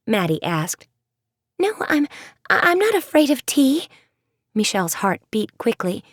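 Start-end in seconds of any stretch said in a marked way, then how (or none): 1.6-3.9 high stumbling denial; flustered stammer. Acted. Michelle denying fear of tea, stammering. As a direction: trip over the words in a panic.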